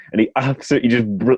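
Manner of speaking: ordinary talk, nothing marked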